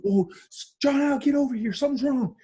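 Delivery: high, panicked mother's voice; worried mom calling for help, pitched high; mom freaking out. Retold or imitated. Mom hearing her son sob on the phone, panicked high. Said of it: "She starts freaking out".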